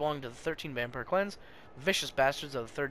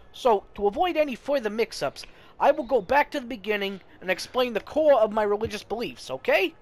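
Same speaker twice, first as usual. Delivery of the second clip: higher, sing-song sermon voice; preachy priest; 5 semitones higher, 5 dB louder than usual. Acted. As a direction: coax her like a patient pastor. Priest Benoit explaining.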